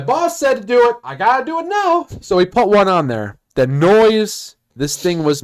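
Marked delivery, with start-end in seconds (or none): 0.0-2.1 high-pitched mock protest. Mock resignation to boss's order.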